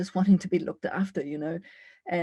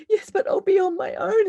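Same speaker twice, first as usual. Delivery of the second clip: frightened self; 13 semitones higher, 6 dB louder than usual. Acted. Inner dialogue, her frightened self.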